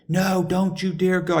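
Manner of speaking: high, slow imitated warning; alarmed girlfriend. Girlfriend forbidding him on phone.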